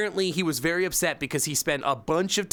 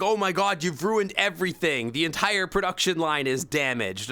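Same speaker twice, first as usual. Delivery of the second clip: mock panicked coworkers; 4 semitones higher than usual. Coworkers blaming the streamer, mocking panic. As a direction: overplay their panic. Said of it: "And then they're like".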